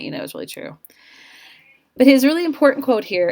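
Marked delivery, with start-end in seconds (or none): 0.0-0.8 soft, voiceless conspiratorial whisper; whispered aside. Real, not acted. Teacher confiding a joke to students, whispered. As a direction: let them in on the secret under your breath. Joking aside about celebrity denials.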